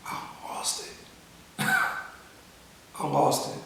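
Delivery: real tears catching the words; a broken, breathy whisper with a wet laugh; choked up. Real, not acted. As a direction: voice breaking into a near-whisper. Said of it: "I still get a little emotional".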